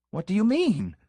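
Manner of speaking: high, cracking cry of alarm; startled pastor, voice cracking high. A narrator's reading. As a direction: let the voice crack a little as he cries out. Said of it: "cried the pastor in a voice which cracked a little".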